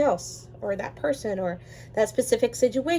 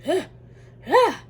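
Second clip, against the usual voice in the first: sudden high loud mock shriek; hammed-up frustrated scream; 8 semitones higher, 7 dB louder than usual. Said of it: "you're screaming".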